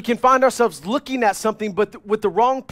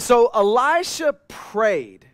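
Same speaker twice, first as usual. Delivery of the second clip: drawn out; 4 semitones higher, much slower than usual. Acted. Preacher draws out the key verse.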